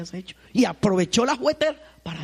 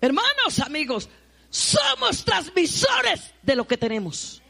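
high, loud, slow preaching cry; preaching shout; 9 semitones higher, 5 dB louder, much slower than usual. Real, not acted. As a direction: proclaim it to the whole church. Preacher addresses the congregation. (grito de predicador)

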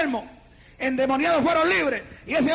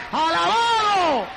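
long, drawn-out shouted praise; 4 semitones higher, 4 dB louder, much slower than usual. Real, not acted. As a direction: cry it out long to the congregation. Preacher shouts praise to congregation. (grito de alabanza alargado)

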